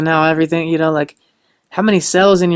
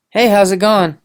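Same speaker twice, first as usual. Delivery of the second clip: bright, friendly hello, a bit higher; cheerful greeting; 3 semitones higher than usual. Retold or imitated. Greeting a cashier at the register, cheerful. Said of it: "I go".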